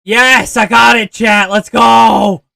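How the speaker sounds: loud, high victory yell; hyped streamer yelling to chat; excited shout. Real, not acted. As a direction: celebrate the win at full volume. Hyped game win, shouting at chat.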